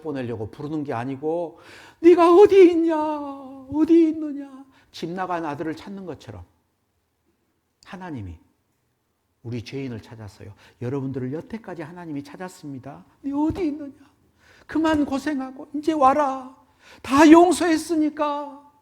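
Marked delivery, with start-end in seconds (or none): 2.0-4.6 high, loud searching call. Acted. Preacher voicing God seeking sinners, a high loud call. 13.2-18.7 high, rising to loud, slow at the end; pleading call. Acted. Preacher voicing God calling the sinner home, high and pleading.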